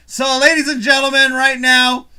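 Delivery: loud, high showman intro; announcer voice. Acted. Man announcing his video to viewers like a showman. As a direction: present it like a ringmaster.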